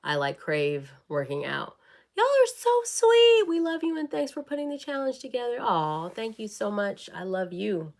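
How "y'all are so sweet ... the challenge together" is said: gushing viewer voice. Acted. Reading a fan's sweet comment in a gushing, much higher voice. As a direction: squeal it out like an adoring fan.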